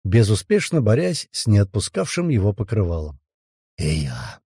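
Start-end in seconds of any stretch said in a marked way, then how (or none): 3.8-4.4 low quiet hoarse croak. A narrator's reading. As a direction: croak it weakly. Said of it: "прохрипел".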